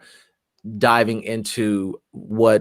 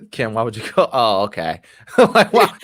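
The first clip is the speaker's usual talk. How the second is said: laughing; 3 semitones higher than usual. Real, not acted. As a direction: crack up while asking why. Laughing at friend's reaction.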